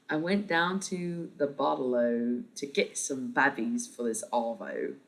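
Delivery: slow, put-on Australian accent; hammed-up amateur Australian slang delivery; attempted Australian accent. Shown as a demonstration. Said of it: "What could I say in an Australian accent?".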